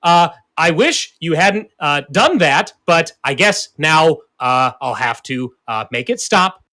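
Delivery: loud, halting creature speech, climbing high at the end; villainous monster voice. Acted. Wounded beast threatens heroine and orders hounds, loud halting monster voice. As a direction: force it out loudly in broken phrases. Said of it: "And he's like".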